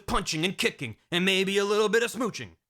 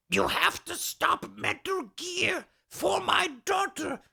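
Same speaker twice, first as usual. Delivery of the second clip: much higher, slow, straining plea; high pleading doctor; 12 semitones higher, slower than usual. Retold or imitated. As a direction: beg him in a cartoon old man voice. Madnar pleads about Ellen.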